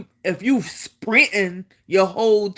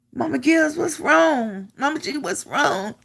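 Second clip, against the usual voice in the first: imitated concern; 7 semitones higher than usual. Battle buddy asks crying soldier what's wrong.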